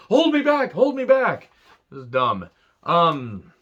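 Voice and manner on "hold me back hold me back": high-pitched mock pleading; mock holding-back bluster. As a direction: play up the empty threat, pitched way up. Mocking Orton standing there.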